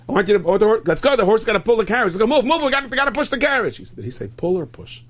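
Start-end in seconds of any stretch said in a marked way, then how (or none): 0.1-3.8 high, loud, hurried commands; flustered shouting to get moving; frantic urging. Acted. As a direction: hustle them along in a panic. Acted hurried orders to move.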